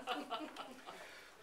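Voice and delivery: quiet airy giggle; a little laugh to yourself; soft laugh. Real, not acted. Laughs after tossing penny.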